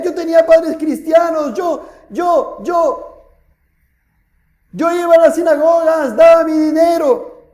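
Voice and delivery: high, insistent pleading shout; crying out. Acted. Rich man lists his religious merits to Abraham, crying out. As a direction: call out each claim, pitched high. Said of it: "Él dando voces".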